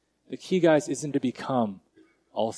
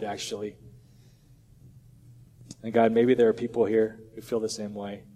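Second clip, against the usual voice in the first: quiet prayer; 5 semitones lower, 4 dB quieter than usual. Acted. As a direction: pray humbly to God, unhurried and hushed. Closing prayer.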